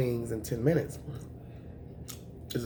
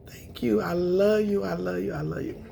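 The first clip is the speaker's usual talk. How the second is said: higher, emotional thanks, fading out; holding back tears; 5 semitones higher than usual. Real, not acted. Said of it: "I don't wanna cry".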